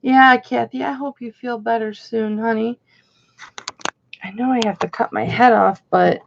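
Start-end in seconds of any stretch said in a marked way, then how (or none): none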